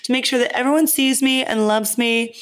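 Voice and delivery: dog's voice. Pretend. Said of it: "he's like".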